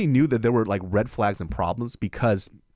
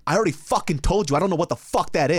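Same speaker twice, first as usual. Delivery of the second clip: retold angry outburst, higher pitch; mock-confrontational, raised pitch; squaring up to fight; 6 semitones higher, 3 dB louder than usual. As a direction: imitate an irritated girlfriend snapping back, stressing the swearing. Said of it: "squared up on me like she was about to scrap".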